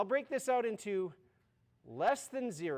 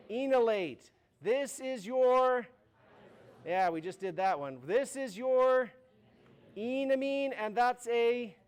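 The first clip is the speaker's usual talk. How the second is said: slow, high, eerie drawn-out naming; spooky announcer reading names; creepy voice; 4 semitones higher, 6 dB louder, slower than usual. Shown as a demonstration. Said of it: "one creepy voice".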